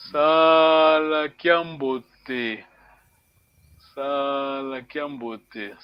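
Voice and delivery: Sala kiambote said slowly, syllable by syllable; slow model phrase. Shown as a demonstration. Teacher models the phrase slowly for a student. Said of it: "can you try to read".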